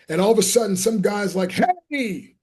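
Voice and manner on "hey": high excited call across the room; excited greeting call. Retold or imitated. Guy calling out across meeting.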